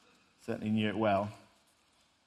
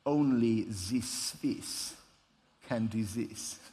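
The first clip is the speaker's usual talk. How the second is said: put-on slow, self-satisfied Swiss accent; smug Swiss accent; 3 semitones lower, much slower than usual. Swiss shop owner behind an amazed customer, smug Swiss accent. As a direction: imitate a proud Swiss shopkeeper's accent, drawn out. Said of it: "nodding quite smugly".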